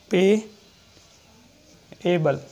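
पेएबल stretched very slowly, each vowel held, पे then ए then बल; drawn-out payable. Shown as a demonstration. Shorthand teacher sounding out पेएबल for students, slow and stretched. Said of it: "तो आपको पूरा sound पे ध्यान देना है".

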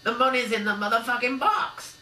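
high, loud thug crowing; gangster gloat. A narrator's reading. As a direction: crow over the win.

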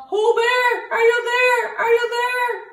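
high calling into the woods. A narrator's reading. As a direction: call out far, voice high. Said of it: "They call".

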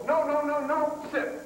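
high, sharp no no no, sit; high-pitched correction to a dog breaking its sit; sharp dog command. Shown as a demonstration. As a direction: snap the command at a dog that moves. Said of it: "and I go".

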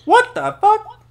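high shocked shout; shocked yell. Real, not acted. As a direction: yell it in disbelief. Shocked at price.

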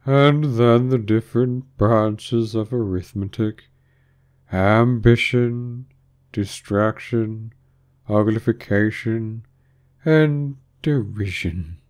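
very slow, drawn-out recital; doleful Mock Turtle. A narrator's reading. Mock Turtle listing branches of arithmetic, slow and solemn. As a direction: intone each subject separately, stretching the vowels.

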